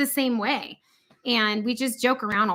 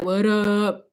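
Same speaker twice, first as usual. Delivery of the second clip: mock gangster; 2 semitones lower than usual. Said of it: "He'd be like".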